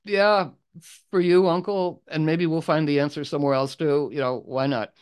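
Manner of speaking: gallantly volunteer for your uncle; young knight eagerly offering to marry for his uncle; eager Gawain. Acted. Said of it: "Gawain says".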